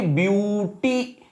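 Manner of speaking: "ब्यूटीफुल" drawn apart, pause before फुल; broken into syllables. Shown as a demonstration. Teacher counting syllables for class, "ब्यूटीफुल" broken up slowly. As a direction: stretch "ब्यूटीफुल" as ब्यू-टी, then फुल. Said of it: "जिनको तोड़कर".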